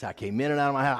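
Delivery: ordinary talk, nothing marked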